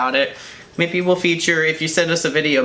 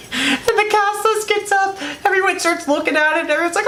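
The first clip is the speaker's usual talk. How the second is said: high, giggly narration through laughter; laughing while telling. Real, not acted.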